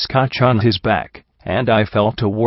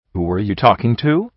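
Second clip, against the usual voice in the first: ordinary delivery, nothing marked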